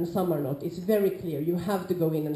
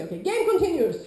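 announcing call; 9 semitones higher, 5 dB louder than usual. Shown as a demonstration. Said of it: "you say".

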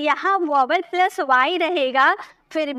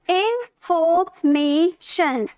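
Information in four slow separate beats; stretched in, for, may, shun for information; syllable by syllable; much slower than usual. Shown as a demonstration. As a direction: pause after each syllable of information. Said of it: "चार syllable हो गए".

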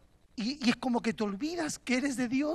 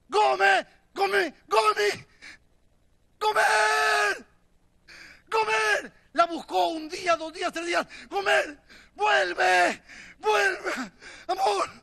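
high, drawn-out shouts after a lost love; desperate calling out; 7 semitones higher, slower than usual. Acted. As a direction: call out, pleading for her return. Oseas busca y llama a Gomer. (llamada desesperada a gritos)

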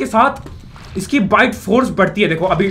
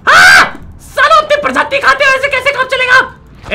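outraged shouting; 5 dB louder than usual. Real, not acted. Scolding fish for cannibalism.

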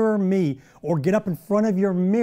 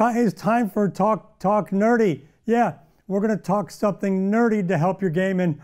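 ordinary talk, nothing marked

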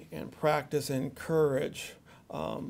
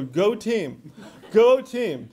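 laughing go team chant, getting louder; mock cheerleading; 3 semitones higher, 7 dB louder, much slower than usual. Shown as a demonstration. Dad vowing only to cheer, hammed-up chant. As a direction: cheer it like a pom-pom fan. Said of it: "I'm just gonna go".

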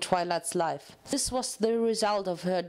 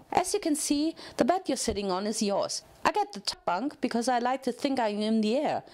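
chirpy, higher pony voice, full of cheer; eager cellmate chattering at a new arrival, chirpy and higher; bubbly Pinkie Pie; 3 semitones higher than usual. Acted. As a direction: bounce every word like an overexcited party pony.

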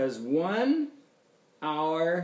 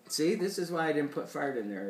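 usual speaking voice

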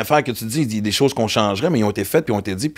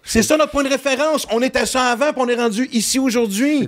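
mock-public exclamation, pitched way up; exclaiming proudly; 12 semitones higher than usual. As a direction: cry it out like a rallying speech. Continues the acted proud exclamation.

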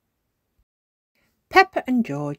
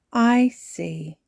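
higher, louder granny voice; granny responding; 6 semitones higher, 6 dB louder than usual. A narrator's reading. Granny answering a child who won't sleep, considering. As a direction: take in the child's protest.

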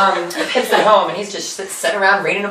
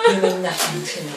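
soft, low-key mock dismissal; hushed rejection; 3 semitones lower, 10 dB quieter than usual. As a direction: drop the voice to dismiss them. Estella's cold rejection, acted.

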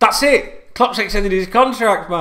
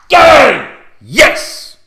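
loud high celebratory yell; real football-fan roar of joy; shouting; 7 semitones higher, 10 dB louder, much slower than usual. Real, not acted. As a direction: bellow it like a goal went in. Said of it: "When I start shouting like that".

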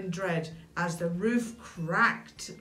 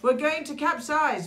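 high, raised cry of alarm; storybook panic, pitched up; panicked cry; 7 semitones higher, 4 dB louder than usual. A narrator's reading. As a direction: plead like ants about to sink. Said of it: "cried the ants".

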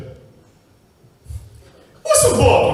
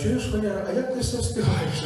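quiet question; 4 semitones higher, 8 dB quieter than usual. Acted. Men asking quietly.

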